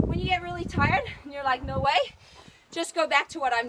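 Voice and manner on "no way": normal talking voice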